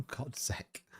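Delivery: very soft, voiceless mutter; exasperated whisper. Real, not acted. Streamer annoyed at deleted indicators, exasperated whisper. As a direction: curse under your breath.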